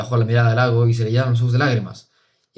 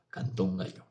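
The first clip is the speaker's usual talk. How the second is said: ordinary delivery, nothing marked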